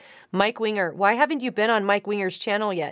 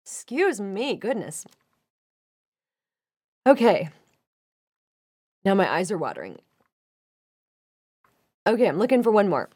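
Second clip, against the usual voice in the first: recovering from a coughing fit; went down the wrong pipe. Real, not acted. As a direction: after choking on a drink. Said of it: "sucked that down the wrong pipe".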